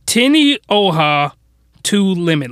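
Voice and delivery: slow, deliberate repeat of a title; careful, drawn-out title; spelled-out title. Shown as a demonstration. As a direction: stretch out "Tenioha", pause, then "two Limit". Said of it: "gonna spell it out for you".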